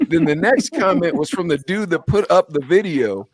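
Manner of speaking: normal talking voice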